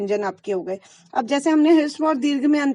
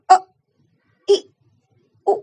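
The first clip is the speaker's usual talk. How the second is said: short quick vowels; 5 semitones higher, 7 dB louder, much slower than usual. Shown as a demonstration. Teacher to students showing short vowels, quick. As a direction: clip each vowel अ, इ, उ very short. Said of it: "तो कितना कम समय लग रहा है".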